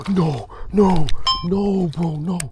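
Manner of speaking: high, loud cries of no; dismayed protest. Real, not acted. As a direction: cry out in real dismay. Cries out as fish action goes wrong.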